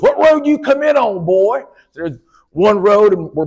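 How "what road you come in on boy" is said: put-on old country man. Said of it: "He goes".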